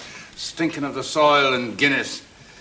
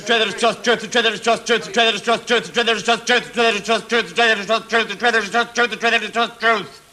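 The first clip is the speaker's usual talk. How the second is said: quick rhythmic repetition of the traitor is just truth, a bit higher; tongue twister drill; 8 semitones higher, much faster than usual. Shown as a demonstration. Class drilling the tongue twister, fast chant. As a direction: rattle the twister off over and over. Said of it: "Tongue twisters".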